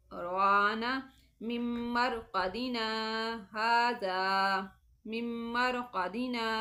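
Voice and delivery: tajweed sakta, each phrase recited twice; sakta recitation. Shown as a demonstration. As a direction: hold the sound, stop without breathing, then go on. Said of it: "मैं फिर से पढ़ती हूँ".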